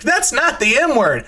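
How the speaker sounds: high, loud dad correction; retold alarmed outburst; shocked protest. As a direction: exclaim it, caught off guard. Retold shocked reply to swearing.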